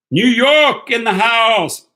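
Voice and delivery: loud, high, drawn-out call; hype shout-out. Real, not acted. Streamer hailing New York in the house, loud shout-out. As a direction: holler it slowly like a hype man.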